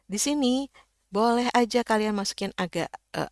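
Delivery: usual speaking voice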